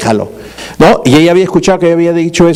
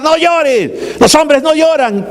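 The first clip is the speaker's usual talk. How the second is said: high-pitched mock scolding of a crying child; harsh father scolding; 9 semitones higher, much slower than usual. Father to his crying little boy, mock scolding. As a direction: imitate a hard dad, slowing on the last words. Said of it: "se pone a llorar".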